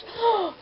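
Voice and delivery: sharp high mock gasp of shock. Vlogger mocks her own shocking opinion with a gasp. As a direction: gasp as if scandalised by yourself.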